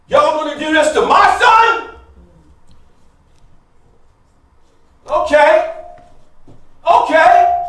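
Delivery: high, loud furious yell at the judges. Retold or imitated. Dad yells at the judges who robbed his son, furious shout. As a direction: explode with helpless rage, shouting. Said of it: "this fury in my dad's eyes".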